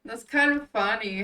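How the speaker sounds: loud teasing amusement between laughs; amused, laughing. Acted. Yale teasing Rinku, loud and amused. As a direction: say it loudly between bursts of laughter. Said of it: "He didn't need to laugh at that".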